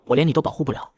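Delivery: plain reading voice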